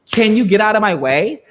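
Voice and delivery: high, loud, mock-snippy demand; imitated old lady snapping at the girl in line; rude old lady. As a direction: impersonate a pushy woman shoving past. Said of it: "backs up and goes".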